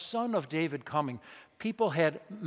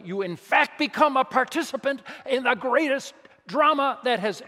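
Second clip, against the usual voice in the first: fervent angel; 9 semitones higher, 6 dB louder than usual. Acted. Angel's message to Joseph, voiced.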